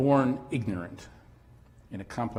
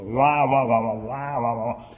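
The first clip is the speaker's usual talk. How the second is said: louder, muffled wah-wah station announcement; garbled loudspeaker; 8 dB louder than usual. Retold or imitated. Train station announcement you cannot understand, louder garbled wah-wah. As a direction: imitate a wonky public address system. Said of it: "the sound system was all wonky".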